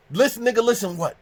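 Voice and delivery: high, loud angry comeback; mad retort. Retold or imitated. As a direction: throw it back at him, outraged. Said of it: "I'm so mad that".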